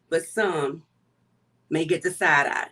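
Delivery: ordinary delivery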